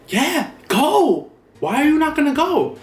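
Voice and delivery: high-pitched exasperated mock yell; insistent yell. Uncle pushing his scared nephew to go, insistent yell. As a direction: imitate an uncle yelling, pitch shooting way up.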